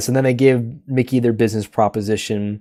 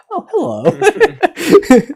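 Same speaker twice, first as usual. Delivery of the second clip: very high, very slow, drawn-out mock greeting with a laugh; a sugary fake-surprised hello, stretched long; mock Mickey greeting; 5 dB louder, much slower than usual. Mickey greeting Dancer after the puzzle is slapped away, high and drawn out. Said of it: "and then Mickey's like".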